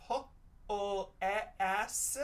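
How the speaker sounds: P-O-E-S as separate short letter sounds, "puh-ll-eh-ah-s"; wrong sounding-out. Shown as a demonstration. Teacher tries P-O-E-S with short vowels, sounding out wrongly. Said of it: "That doesn't sound quite right".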